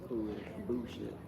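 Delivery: usual speaking voice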